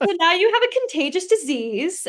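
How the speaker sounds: hammed-up doom through laughter, high and loud; mock doom. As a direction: announce the incurable disease like a joke, laughing. Mock dramatic diagnosis, laughing.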